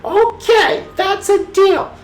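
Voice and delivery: a high, playful cackling witch; storybook witch. A narrator's reading. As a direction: Hilda greets Louie in a high witch voice. Hilda the witch voiced.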